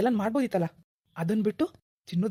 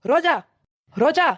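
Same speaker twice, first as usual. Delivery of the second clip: Roja yelled higher and louder with a rising end; shouting for Roja; 6 semitones higher, 5 dB louder, slower than usual. Acted. Husband angrily shouts for his wife Roja. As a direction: bellow Roja, demanding she come now. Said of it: "ಯಾಕ್ರಿ ಹಿಂಗ್ ಕಿರ್ಚಿದ್ರಿ".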